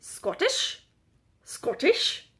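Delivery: clumsy Scottish accent, rising like a question; badly faked Scottish accent; attempted Scottish accent. Shown as a demonstration. Vlogger trying out a Scottish accent for viewers, badly faked. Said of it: "pretend like I know what a Scottish accent sounds like".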